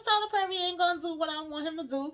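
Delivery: usual speaking voice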